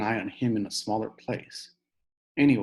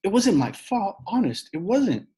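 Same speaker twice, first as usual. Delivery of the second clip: crying; 9 semitones higher, 4 dB louder than usual. Acted. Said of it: "Sheila cried".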